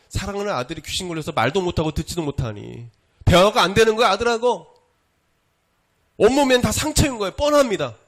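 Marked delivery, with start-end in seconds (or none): 3.2-8.0 high, very loud pulpit outburst; forceful preaching. Real, not acted. Preacher laying out the father's plight with his son, forceful preaching. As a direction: push out each point high and loud.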